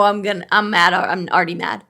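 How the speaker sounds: normal talking voice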